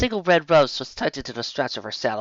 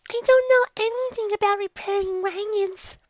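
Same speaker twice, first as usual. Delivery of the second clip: high, unsure little filly; timid young filly. A narrator's reading. Dinky voice.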